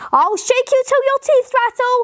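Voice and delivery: high, put-on angry yell; mock shout in a high strained-up voice. A narrator's reading. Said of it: "yelled the man".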